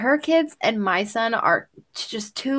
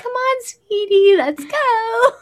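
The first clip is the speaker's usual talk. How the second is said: high, sing-song sweet mommy tone; a gentle mother coaxing her son, sugary; 13 semitones higher, 4 dB louder, slower than usual. Retold or imitated. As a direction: coo it like an overly gentle parent. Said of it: "I'm like".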